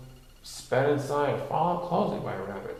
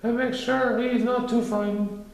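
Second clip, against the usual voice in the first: high Pooh voice; 11 semitones higher than usual. A narrator's reading.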